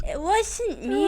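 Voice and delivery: put-on high, slow, silly kid voice; buck-toothed kid voice. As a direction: play a goofy buck-toothed child. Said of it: "our buck teeth and our glasses and we go".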